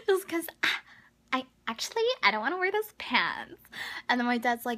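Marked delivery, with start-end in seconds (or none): 0.1-3.6 high, loud bratty whine; mock whiny. Mocking the old man, a high bratty whine. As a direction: whine like a sulky teen.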